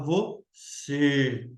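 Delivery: "você" with its final Ê closed and drawn out. Shown as a demonstration. Teacher demonstrating the circumflex, "você" with closed final Ê. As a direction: hold a closed ê at the end of "você". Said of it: "O E fica fechado".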